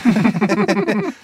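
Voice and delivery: high-pitched giggling; laughter. A narrator's reading. Gang making up after Sarah's apology, giggling. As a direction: break into a giggle. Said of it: "Jule und Leo lachen".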